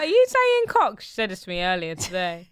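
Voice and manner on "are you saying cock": high, loud incredulous squeal; exaggerated mock shock; shocked question. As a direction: retell it in high-pitched, scandalized disbelief. Retelling her shocked question.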